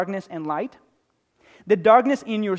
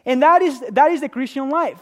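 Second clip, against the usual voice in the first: higher and louder, driving the point home; fervent preaching; 6 semitones higher, 6 dB louder than usual. Acted. Preacher to congregation, fervent sermon voice. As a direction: preach it with full force.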